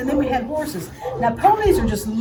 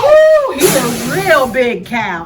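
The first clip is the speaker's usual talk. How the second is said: excited; 7 dB louder, slower than usual. Acted. Host thrilled at the cow.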